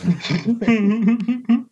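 loud, high cackle; loud laughing fit. Real, not acted. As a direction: howl with laughter. Joking about a URL podcast.

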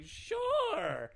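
put-on high little-old-lady reply. Gamer mimicking the grandma at GameStop, high and sweet.

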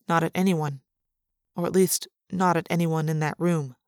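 plain reading voice

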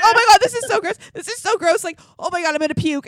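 high, loud disgusted wail; retelling him about to vomit; husband gagging, grossed out. Retold or imitated. Husband cleaning dog puke, grossed out, high loud wail. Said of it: "he's like".

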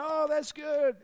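high, loud "Oh" of an impressed listener; impressed crowd. Retold or imitated. A crowd hearing the chair illustration, impressed and high. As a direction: sound like a room won over. Audience reacting to a theologian.

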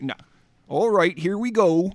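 put-on rustic British Hobbit accent. Shown as a demonstration. Showing co-host the one accent they can do, Hobbit accent. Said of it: "a Hobbit accent".

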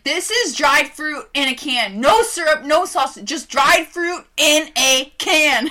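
loud, high mocking imitation of friend. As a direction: parody her, loud and high. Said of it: "You're like".

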